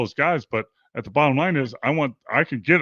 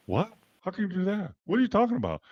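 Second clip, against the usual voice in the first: incredulous protest; 6 semitones higher, 5 dB quieter, faster than usual. Retold or imitated. Players' stunned reaction to being cut.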